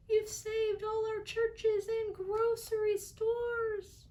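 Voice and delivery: very high, slow tiny voice; tiny Who voice. A narrator's reading. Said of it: "came the voice".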